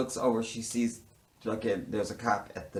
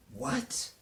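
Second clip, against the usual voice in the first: shocked; 13 semitones higher, 6 dB quieter than usual. Retold or imitated. Shocked retold reaction.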